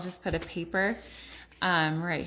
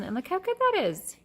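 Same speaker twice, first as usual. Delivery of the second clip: excited praise; 11 semitones higher, 6 dB louder than usual. Real, not acted. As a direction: praise your little one, pitched way up. Mother praising her small child.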